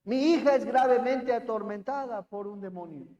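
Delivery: loud begging for her tormented daughter; loud pleading cry. Acted. Mother begging Jesus to heal her daughter, loud cry. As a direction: cry it out, desperate. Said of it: "clamaba, es decir, gritaba".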